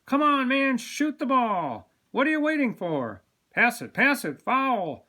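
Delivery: high, loud sports-fan hollering; yelling at the game. A narrator's reading. Men in barbershop watching basketball, excited yelling. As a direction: urge the players on from the sidelines.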